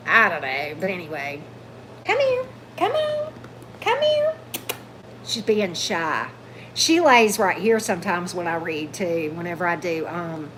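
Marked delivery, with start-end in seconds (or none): 2.1-4.3 high, coaxing pet voice to the dog; sing-song sweet talk to lure a shy dog out; calling the dog. Real, not acted.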